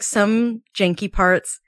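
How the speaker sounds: usual speaking voice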